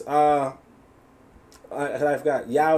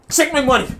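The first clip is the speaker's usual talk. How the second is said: high-pitched mock eagerness; mock eager buyer; 9 semitones higher, 4 dB louder than usual. Host imitating fans throwing cash at a claimant, mock eager. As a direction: play an over-keen customer.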